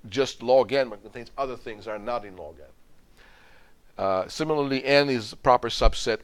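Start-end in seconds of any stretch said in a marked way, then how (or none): 4.8-6.2 proper said with weight, the whole line louder; emphasize proper for the class; stress on proper. Shown as a demonstration. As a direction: lean on the word proper. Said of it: "proper, emphasis on the word proper".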